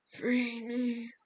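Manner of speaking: put-on distant older woman's voice; possessed echoing voice. A narrator's reading. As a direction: speak as if from a long pipe. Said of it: "it wasn't Rachel's voice. It sounded like an older woman speaking from somewhere far away".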